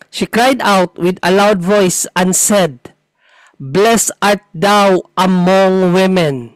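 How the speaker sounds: very slow, deliberate verse reading; scripture reading. Acted. Pointing a brother to the word "among", slow scripture reading. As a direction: spell out the verse phrase by phrase.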